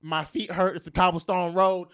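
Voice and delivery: usual speaking voice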